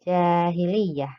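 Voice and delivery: "Jahiliyah" slow, first a held long; long vowel demo. Shown as a demonstration. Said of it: "huruf yang dibaca panjang".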